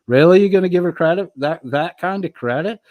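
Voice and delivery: high, loud incredulous really; mock disbelief. Real, not acted. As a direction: act shocked at the credit given. Mock outrage at chat comment.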